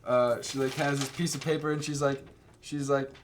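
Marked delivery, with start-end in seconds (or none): none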